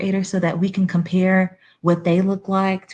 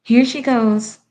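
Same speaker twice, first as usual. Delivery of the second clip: ordinary talk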